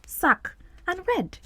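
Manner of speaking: "sac" and "red" spaced apart, high then falling; "sac", pause, high "red"; two separate words. Shown as a demonstration. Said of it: "it looks like we have the two words".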